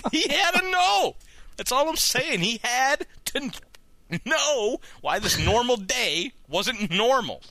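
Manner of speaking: high-pitched, hammed-up defense; mock-dramatic insistence. Jokingly defends the story just read.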